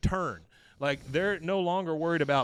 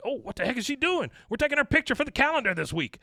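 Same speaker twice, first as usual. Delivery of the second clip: much higher, fast, annoyed whine; exasperated whine; 10 semitones higher, 4 dB louder, faster than usual. Retold or imitated. Buck annoyed at Luann fighting, high fast whine. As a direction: fret about your calendar photo.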